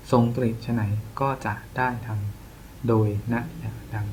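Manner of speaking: hushed reading to oneself; reading under breath. Real, not acted. Tutor checking the next verse, reading under breath. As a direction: read it very softly, as if checking.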